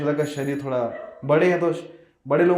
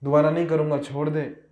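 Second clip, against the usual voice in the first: usual speaking voice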